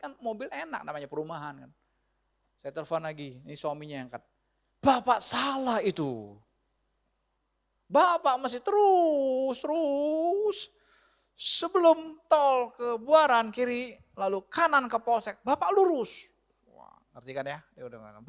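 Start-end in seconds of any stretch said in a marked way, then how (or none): 4.8-16.4 loud, drawn-out, higher-pitched instructions; insistent directions. Acted. Husband on the phone correcting the lost driver, loud and insistent. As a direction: stretch each direction out emphatically.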